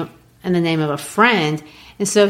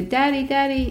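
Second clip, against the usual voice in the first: put-on little boy; 7 semitones higher, 4 dB quieter than usual. Child Travis voiced in story.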